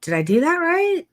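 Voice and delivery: a nervous client who checks if it's right; awkward, rising, unsure vowels. Retold or imitated. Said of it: "I would be like".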